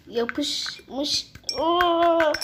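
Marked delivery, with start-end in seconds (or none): 1.6-2.3 sudden startled oh; surprised. Real, not acted. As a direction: caught off guard by a falling candy. Candy nearly falls on floor.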